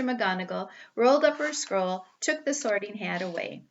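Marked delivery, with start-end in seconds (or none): none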